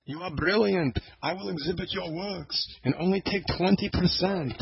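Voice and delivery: high, put-on Yiddish accent. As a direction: voice a Yiddish-accented art dealer. Same dealer voice continues.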